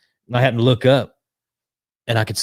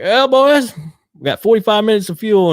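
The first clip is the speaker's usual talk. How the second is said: high, loud mock pilot; pilot's warning; 11 semitones higher, 7 dB louder than usual. Pilot turns back to the passengers, high loud warning. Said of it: "the pilot looks back and is like".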